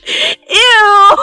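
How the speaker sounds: high, loud disgusted Ew; grossed-out squeal. Real, not acted. Reader imagines Kirishima spitting while laughing, grossed-out squeal. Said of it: "Kirishima's out here spitting".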